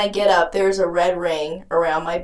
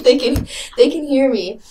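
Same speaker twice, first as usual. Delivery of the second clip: ordinary delivery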